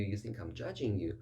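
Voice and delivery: usual speaking voice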